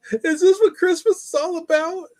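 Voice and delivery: high, loud hammed-up wail; mock outcry. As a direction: wail it in joking dismay, way up high. Mock outcry over lame gifts.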